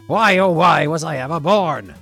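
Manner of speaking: loud theatrical wail; despairing shout. A narrator's reading. Soup curses his returning hiccups, loud hammed-up despair. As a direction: cry out in mock-tragic exasperation. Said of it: "Soup shouted an oath".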